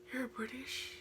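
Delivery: hushed, breathless "You're British?"; amazed whisper. Retold or imitated. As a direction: whisper it like you can't believe it. Classmates amazed by accent.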